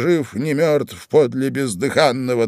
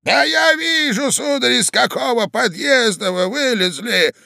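high, scolding outburst; indignant shout; 11 semitones higher than usual. A narrator's reading. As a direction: cry out in exasperation. Old man rages at intruder. (возмущённый окрик)

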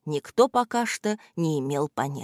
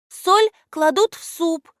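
put-on high, slow musing girl; thoughtful girl; 11 semitones higher, 4 dB louder than usual. A narrator's reading. Alya remembers mum's borscht: high slow musing. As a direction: muse on it like a little girl. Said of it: "задумчиво произнесла Аля".

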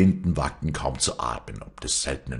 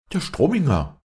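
high, louder startled exclamation; name him in startled alarm; almost startled; 12 semitones higher, 5 dB louder than usual. A narrator's reading. Bystanders recognise the threatening voice, almost startled. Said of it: "sagten fast erschrocken".

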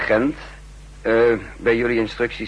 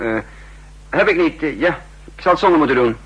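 usual speaking voice

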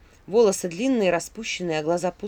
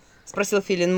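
usual speaking voice

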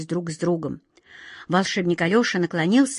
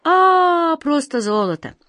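high drawn-out "ah", then sinking in disappointment; disappointed; 7 semitones higher, 4 dB louder, slower than usual. Acted. Wizard learning it is only gold, disappointed. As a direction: let the letdown show. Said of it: "не мог скрыть своего разочарования".

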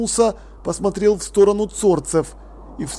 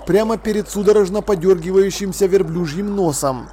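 ordinary delivery, nothing marked

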